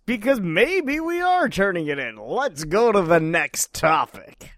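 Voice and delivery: booming fighting game announcer. Pretend. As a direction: announce it big and slow. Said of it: "you just turned on, like, announcer voice".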